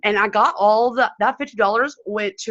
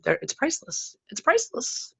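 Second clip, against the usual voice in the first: high, soft, choked-up voice; trying not to cry; 7 semitones higher, 8 dB quieter than usual. Real, not acted. Ambassador moved by the connections she made, trying not to cry. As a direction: hold back tears, voice small and raised. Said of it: "I'm trying not to cry".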